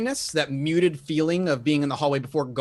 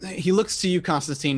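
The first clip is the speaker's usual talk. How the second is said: ordinary talk, nothing marked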